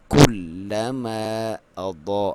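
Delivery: slow drawn-out tajweed chant; Quran recitation. Shown as a demonstration. Teacher recites the verse for students, slow chant. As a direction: recite it slowly with full tajweed. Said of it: "اب میں نے چھوڑا ہے اسے".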